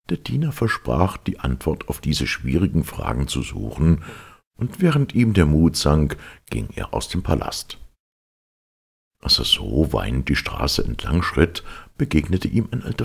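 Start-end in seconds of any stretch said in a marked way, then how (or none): none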